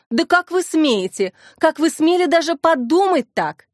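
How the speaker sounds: mildly acted outrage at an accusation; indignant. A narrator's reading. Обвинённая в убийстве возмущается. (возмущённо)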